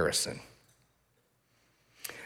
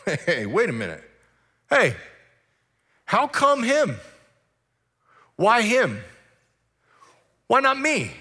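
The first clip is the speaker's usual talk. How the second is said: high, whiny complaint; whining at God; 7 semitones higher than usual. Retold or imitated. Believer whining to God, high complaining voice. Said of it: "Do we ever go to the Lord and go".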